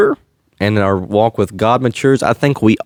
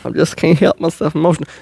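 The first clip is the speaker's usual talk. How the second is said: higher, fast, whiny imitation of a flustered crier; mock apologetic crier; 7 semitones higher, faster than usual. Mocking people who apologize for tearing up, higher and fast. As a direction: mockingly rush through the sorry-I'm-emotional apology. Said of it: "they say".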